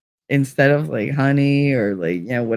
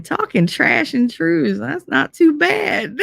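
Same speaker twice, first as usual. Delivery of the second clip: high, slow, wobbly cutesy voice; Monty's voice; 10 semitones higher, slower than usual. Retold or imitated. Streamer imitates her kid-like friend Monty, high and slow. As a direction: draw it out high like a sweet little kid. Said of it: "I have one of Monty saying".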